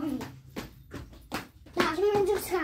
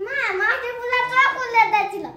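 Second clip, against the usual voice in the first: calling out loud; 5 semitones higher, 8 dB louder than usual. Real, not acted. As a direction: shout it across to mom. Child calling mother, repeating. (জোরে ডাকা)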